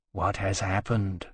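soft and slow, hushed concern; slow, quiet question in the dark; asked quietly. A narrator's reading. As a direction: ask it gently, barely above a murmur. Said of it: "Temeraire asked quietly".

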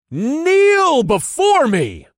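high, booming, drawn-out order; commanding shout. Acted. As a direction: command a crowd with full force. Commands the gathered cultists to kneel.

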